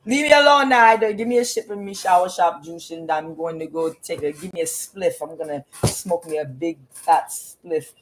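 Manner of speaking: hammed-up Jamaican patois complaining; mock Jamaican accent ranting; Jamaican caricature. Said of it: "characterizing Jamaicans".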